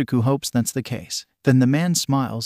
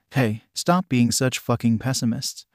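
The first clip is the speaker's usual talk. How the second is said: ordinary delivery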